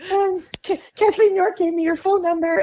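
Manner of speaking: high, nervous, fumbling self on the phone; tongue-tied and nervous. Retold or imitated. Her younger self nervously calling the casting director, high and tongue-tied. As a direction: squeak out a nervous hello. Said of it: "I was so tongue-tied when she answered the phone".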